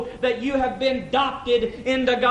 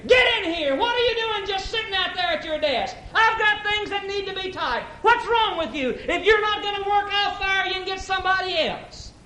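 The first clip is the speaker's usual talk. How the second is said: hollering boss; 9 semitones higher than usual. Acted. Boss hollering at his secretary, angry and raised. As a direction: bark orders at the secretary. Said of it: "He hollers at his secretary".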